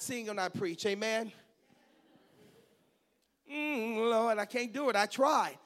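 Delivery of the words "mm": ordinary talk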